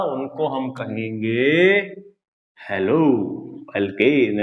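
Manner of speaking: teacher reveal, slow and low. Shown as a demonstration. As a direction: pause before hello alkene like an answer. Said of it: "तो उनको हम क्या कहेंगे भैया?".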